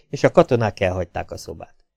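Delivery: ordinary delivery, nothing marked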